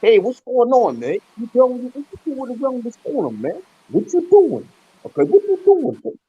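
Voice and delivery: high-pitched Brooklyn street talk; Brooklyn accent. Retold or imitated. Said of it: "They talked like this".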